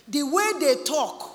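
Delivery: ordinary delivery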